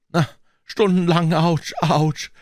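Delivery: high, whiny cries of pain; hammed-up achy boy voice; groaning.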